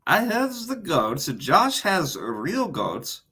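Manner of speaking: lilting mock Borat accent. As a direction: answer in a hammed-up Borat accent. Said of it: "Borat accent".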